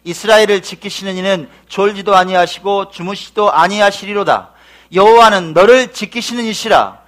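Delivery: loud, measured recitation; projected, declaimed Bible verse; scripture reading aloud. Real, not acted. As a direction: lead a congregation reading in unison. Leads congregational scripture reading.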